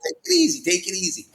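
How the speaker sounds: high soothing take it easy; calming someone down. Retold or imitated. Friends calming wound-up Adam, soothing. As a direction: hush a wound-up man. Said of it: "'Cause I heard that a lot".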